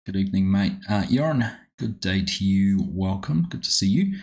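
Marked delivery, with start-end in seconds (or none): 3.5-4.2 much higher, rising friendly hello; sing-song greeting. Real, not acted. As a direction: lift it up warmly to a viewer. Streamer greeting viewer.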